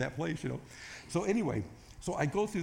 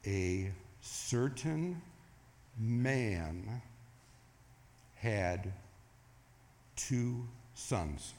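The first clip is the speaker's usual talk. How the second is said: one word at a time, long pauses; extremely slow reading; 2 semitones lower, 3 dB quieter, much slower than usual. Retold or imitated. Preacher re-enacting his careful rereading of the parable, extremely slow. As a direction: draw out each word with big gaps between. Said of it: "I'm gonna read it really, really slow".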